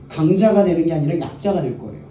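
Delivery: ordinary talk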